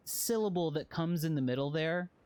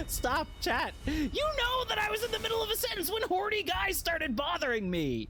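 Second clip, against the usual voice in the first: laughing. Real, not acted. Laughing at game monsters.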